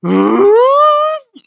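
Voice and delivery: loud, very high drawn-out gasp; collective shocked gasp. Acted. Reader voicing all the Straw Hats reacting, shocked gasp. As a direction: gasp big and high, held long. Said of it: "all the Straw Hats just go".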